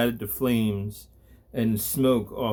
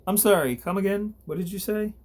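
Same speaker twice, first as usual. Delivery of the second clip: mock disbelief; 9 semitones higher, faster than usual. Gabriel taunts the thug.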